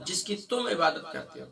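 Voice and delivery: ordinary talk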